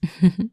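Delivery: a bright, loud laugh; short, loud, pleased laugh. Real, not acted. Laughs at compliment.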